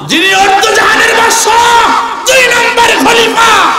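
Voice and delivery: very high, shouted chant; full-force, high-pitched preacher's cry; thundering proclamation. Acted. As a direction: belt out the titles to the whole crowd. Thundering reveal of caliph's identity.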